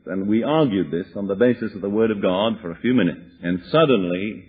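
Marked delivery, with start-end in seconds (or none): none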